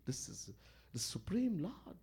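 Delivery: hushed whisper; whispered in reverent awe; awed whisper. Real, not acted. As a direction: breathe it in reverence. Awe at the divine.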